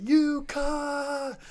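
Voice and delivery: high, strung-out mock curse; drawn-out cursing. Hit rider cursing the lady, high drawn-out accusation.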